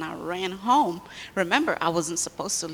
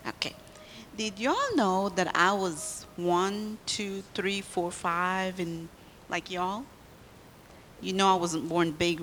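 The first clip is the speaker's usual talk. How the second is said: ordinary talk, nothing marked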